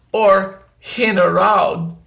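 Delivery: ordinary talk, nothing marked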